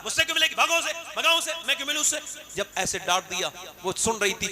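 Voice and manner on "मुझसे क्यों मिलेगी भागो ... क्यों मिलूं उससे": scolding. Retold or imitated. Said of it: "हजरत मूसा ने डांटा".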